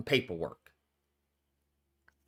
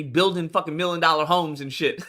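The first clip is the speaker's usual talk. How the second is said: ordinary talk, nothing marked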